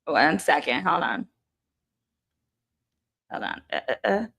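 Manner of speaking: hushed off-mic aside; quiet aside. Real, not acted. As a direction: turn away and talk quietly. Talking to family off-mic.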